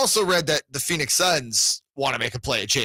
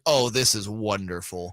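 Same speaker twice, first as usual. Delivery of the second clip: low, slow, softer contented sigh of words; blissful fan; 8 semitones lower, 4 dB quieter, slower than usual. Retold or imitated. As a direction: savour it slowly like a delighted fan. Voicing a contented Kings fan.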